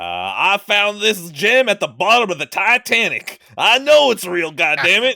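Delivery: loud, high mock outrage; indignant customer. Customer claiming a Titanic gem, loud and high.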